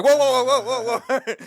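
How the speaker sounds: very high, loud, alarmed whoa; startled whoa. Retold or imitated. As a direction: shriek it out as if jumped at. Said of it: "And it's like".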